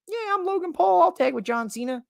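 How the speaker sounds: high squeaky mocking Logan voice; mock Logan Paul. Logan Paul agrees to tag, in a high mocking voice.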